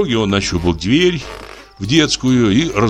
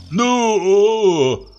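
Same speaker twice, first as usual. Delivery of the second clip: higher drowsy mumble, half asleep; sleepy moan; 10 semitones higher, much slower than usual. Acted. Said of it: "промычал".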